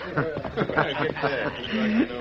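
a short scornful chuckle at the captive; mocking outlaw laugh. Outlaw laughing at the captive's question. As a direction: laugh him off as a dumb question.

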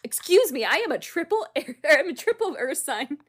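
high, loud offended outburst; mock indignation. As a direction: protest loudly with hammed-up offense, pitch up. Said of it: "you just go into".

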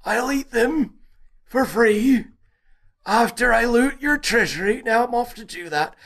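very high mock Viking voice like a very old woman; a squeaky, ancient Viking raider; hammed-up high Viking accent. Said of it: "you sounded like Vargelia ... ninety-five years old".

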